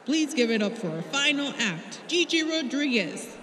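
announcer. A narrator's reading. Drama teacher introducing final act to crowd, announcing. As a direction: announce to the crowd as from afar, name rising. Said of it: "Mister Kim announced her name to the crowd".

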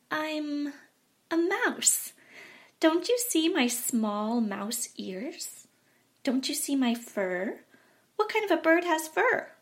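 high, pleading little bat; small bat talking its way out; squeaky bat voice. A narrator's reading.

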